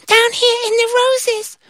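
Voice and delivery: very high tiny voice; tiny ant voice. Acted. Tiny ant offering help, very high squeak. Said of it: "this tiny little voice".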